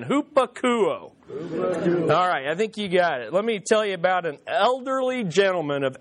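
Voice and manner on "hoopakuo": slow call-and-response Hoopakuo; drawn-out Hoopakuo. Shown as a demonstration. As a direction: say Hoopakuo slowly again, hoo-pa-ku-o. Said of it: "Say it again".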